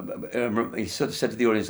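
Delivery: ordinary talk, nothing marked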